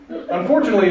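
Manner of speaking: normal talking voice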